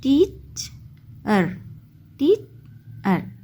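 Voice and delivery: Teacher pulled apart very slowly, "teach", long pause, "er"; split into syllables. Shown as a demonstration. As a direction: stretch teacher into two isolated chunks, teach - er. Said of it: "तो ये टू syllable word है". (Teacher को धीरे-धीरे तोड़कर: टीच - अर)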